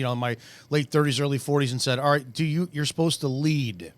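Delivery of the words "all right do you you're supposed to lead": normal talking voice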